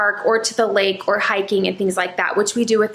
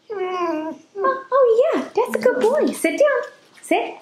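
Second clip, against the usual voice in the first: much higher sing-song praise for a pet; 13 semitones higher, slower than usual. Real, not acted. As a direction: coo at the dog like a proud owner. Praising her dogs.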